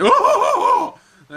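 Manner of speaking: high, hooting laugh like a chicken; character's laugh. Acted. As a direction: cackle like a fussy hen. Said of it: "With a laugh".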